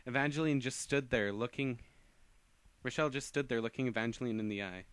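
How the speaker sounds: ordinary delivery, nothing marked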